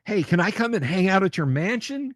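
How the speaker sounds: usual speaking voice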